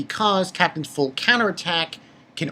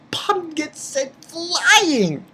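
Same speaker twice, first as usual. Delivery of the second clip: ordinary talk, nothing marked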